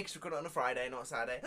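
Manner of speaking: normal talking voice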